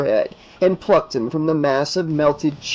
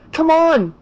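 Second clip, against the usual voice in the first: high, louder urgent shout; 14 semitones higher, 4 dB louder than usual. Acted. Alice calling Dan to the truck, urgent shout. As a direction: call out to hurry him. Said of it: "she shouted".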